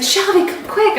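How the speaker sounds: high-pitched urgent call. Retold or imitated. Husband calling his wife downstairs, high and urgent. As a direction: call her over in a hurry.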